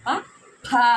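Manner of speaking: ప and ఫ pressed firmly; pressed pronunciation. Shown as a demonstration. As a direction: press hard on ప, ఫ. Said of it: "నొక్కి పెట్టి చెప్పాలి". (ప, ఫ లను నొక్కి పలకడం)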